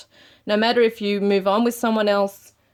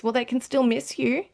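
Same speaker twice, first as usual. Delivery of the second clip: ordinary delivery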